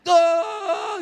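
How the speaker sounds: high, long mock baby wail like a goal shout; imitating a child's big cry; imitated baby wail. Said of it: "essa criança vai ser um locutor de futebol".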